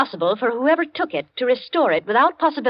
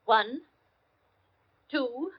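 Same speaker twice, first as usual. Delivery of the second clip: slow count; 2 semitones higher, 7 dB quieter, much slower than usual. Acted. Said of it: "we're going to count one hundred slowly".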